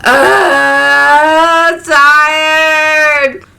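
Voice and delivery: loud, high drawn-out cry; half-sung, very loud wail; mock wail. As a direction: belt it out, holding the vowels long. Drawn-out theatrical outburst mid-story.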